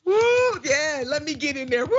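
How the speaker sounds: high joyful whooping; excited whoop. Real, not acted. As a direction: cheer at the top of your voice. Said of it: "I'm just so giddy".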